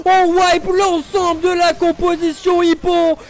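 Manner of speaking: high, loud hype play-by-play; esports caster. Acted. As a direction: scream the teamfight call live. Teamfight hype call.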